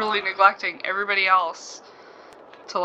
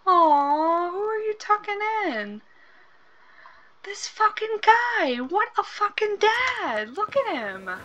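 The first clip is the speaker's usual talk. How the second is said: much higher, slow swooning praise; squealing fan of a sweet dad; gushing adoration; 7 semitones higher, slower than usual. Real, not acted. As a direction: melt over how sweet he is. Gushing over the dad sim.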